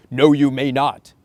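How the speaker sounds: loud, slow, stern refusal; scared parent's refusal. Retold or imitated. Scared parent forbidding the child, loud and slow. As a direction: bark it out like a frightened parent. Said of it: "Your reaction is gonna be".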